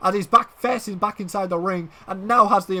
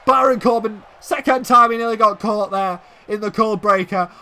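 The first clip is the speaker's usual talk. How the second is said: normal talking voice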